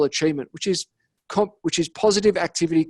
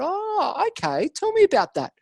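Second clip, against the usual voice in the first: mock sceptical; 13 semitones higher than usual. To the client with the Fanta, high mock doubt. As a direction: play along, not buying it. Retold reply to client's excuse.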